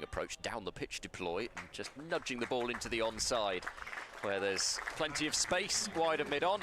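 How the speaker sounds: loud, high, thrilled call of a milestone; excited commentary. Real, not acted. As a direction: shout it out, celebrating the fifty. Commentator calls the half century.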